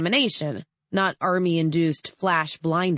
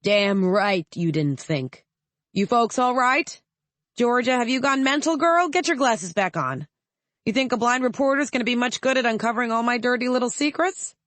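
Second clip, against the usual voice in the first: booming senator; 7 semitones higher than usual. A narrator's reading. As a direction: boom out over the crowd. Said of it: "Senator Ryman boomed".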